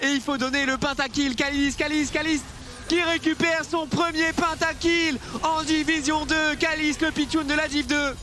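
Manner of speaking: esports caster. Acted. Caster hypes a pentakill.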